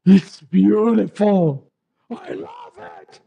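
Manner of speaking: breathless awe, acting out a delighted child; gasping wonder. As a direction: gasp it out, drawn-out and overwhelmed. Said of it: "I'm gasping".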